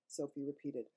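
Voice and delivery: plain reading voice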